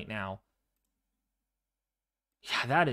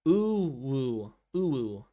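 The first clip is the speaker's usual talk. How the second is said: lightly put-on girl's voice; slower than usual. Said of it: "in a girl's voice".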